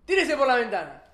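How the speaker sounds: loud commanding shout; barked order. Acted. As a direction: bark the order like a king to his soldier. King orders soldier to jump. (orden gritada de un rey)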